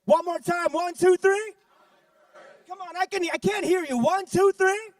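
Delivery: loud, high crowd-pumping count; hype countdown. Real, not acted. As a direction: fire up the crowd. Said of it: "put a hand up like this and say".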